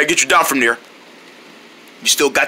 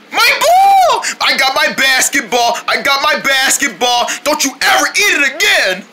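high-pitched excited cheer; overjoyed exclamation; 8 semitones higher than usual. Acted. Overjoyed at getting ball back.

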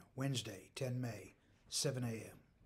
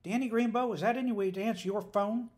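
loud, high irate demand; 9 semitones higher, 9 dB louder than usual. A narrator's reading. Mother scolding her daughter on the phone, irate. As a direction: demand it sharply, much louder and higher. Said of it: "demanded a somewhat irate voice".

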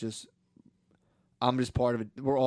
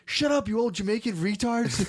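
loud, high-pitched mock outburst; imitated yelling; 9 semitones higher, 5 dB louder than usual. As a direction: yell it like an outraged kid. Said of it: "Joshua was yelling at the fucking".

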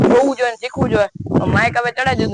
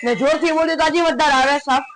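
louder; 6 semitones higher, 5 dB louder than usual. Shown as a demonstration. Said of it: "ધીમે બોલે તો આવે હે ને".

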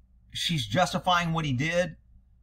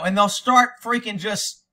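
ordinary delivery

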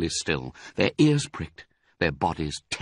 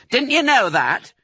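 woman voice; 13 semitones higher, 5 dB louder than usual. A narrator's reading. Women calling between floors.

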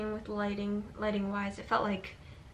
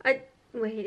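usual speaking voice

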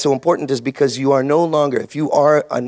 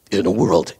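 trailer voice; 7 semitones lower than usual. Retold or imitated.

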